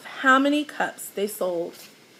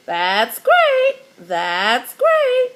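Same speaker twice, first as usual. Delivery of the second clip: bragging parrot; 6 dB louder, much slower than usual. A narrator's reading. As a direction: crow it like a proud parrot. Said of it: "bragged Petey".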